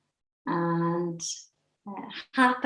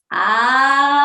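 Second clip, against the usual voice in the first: aloha laughter; 5 semitones higher, 4 dB louder than usual. Shown as a demonstration. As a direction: stretch the word, climbing, then burst into ha-ha-ha. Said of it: "So it looks like this".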